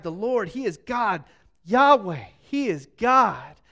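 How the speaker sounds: loud, drawn-out proclamation; crying out. Retold or imitated. People fallen prostrate before Yahweh, crying out. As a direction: cry it out in awe, leaning hard on each phrase. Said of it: "they fell prostrate and cried".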